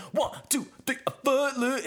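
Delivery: high sing-song count-in; mock count-in. Bandleader counting the orchestra into Footloose, high and sing-song. Sound check count-in again.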